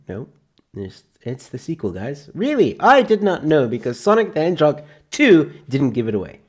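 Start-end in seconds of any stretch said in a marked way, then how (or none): 2.3-6.4 high, loud mock astonishment; hammed-up sarcastic disbelief; sarcastic surprise. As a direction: fake shock, dripping irony. Sarcasm about obvious sequel.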